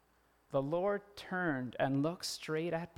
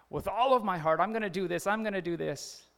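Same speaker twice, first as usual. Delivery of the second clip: raised pitch, insistent promise; earnest big vow; 5 semitones higher, 6 dB louder than usual. Acted. Believer making a big claim to God, insistent. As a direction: pledge it eagerly, pressing each promise.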